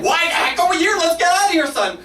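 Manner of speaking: high yelling dad voice; mock shout. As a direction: bark at your son to leave. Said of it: "I was like".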